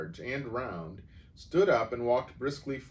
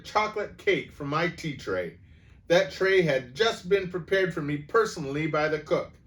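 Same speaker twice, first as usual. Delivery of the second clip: higher, louder outraged rant; furious tirade; 8 semitones higher, 9 dB louder than usual. A narrator's reading. Headmistress denouncing the cake theft, furious. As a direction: pound out the charge.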